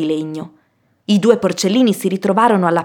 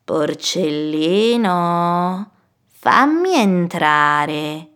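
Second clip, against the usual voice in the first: very slow, drawn-out wolf voice; wheedling wolf, long lingering syllables; coaxing wolf; much slower than usual. Acted. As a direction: coax the pig to open up, stretching each word. Wolf coaxes at the door.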